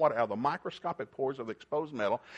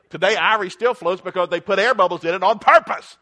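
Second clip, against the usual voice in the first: belted out, higher and louder; a preacher's triumphant shout to the crowd; loud preaching; 6 semitones higher, 12 dB louder than usual. Real, not acted. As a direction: drive the punchline home full voice. Preacher building to a climax.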